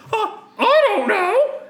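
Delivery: very high, loud put-on voice; hammed-up high lady's voice.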